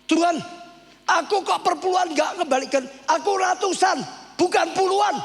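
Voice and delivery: indignant retold outburst at God; angry protest. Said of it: "Saya lebih marah". (protes marah sambil berteriak)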